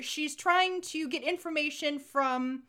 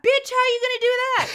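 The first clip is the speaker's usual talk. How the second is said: loud, fast, high-pitched mock outburst; incredulous yell; 10 semitones higher, 10 dB louder, faster than usual. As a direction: yell at the screen in disbelief. Acted outburst at the film.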